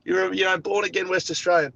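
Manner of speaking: lightly sending up what the locals say; a hint of a teasing imitation; mocking locals. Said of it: "People over here say".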